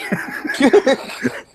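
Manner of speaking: airy burst of laughter at the memory; breathy laugh. Real, not acted. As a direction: let a voiceless laugh spill out. Laughing at his own story.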